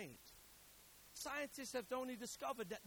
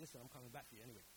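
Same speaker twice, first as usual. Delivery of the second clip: mock threat; 7 semitones lower, 9 dB quieter, faster than usual. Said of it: "we'd be like".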